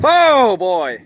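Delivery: put-on sudden high loud "Oh, boy"; a startled yelp at the thunder; startled cry. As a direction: jolt up at the thunderclap.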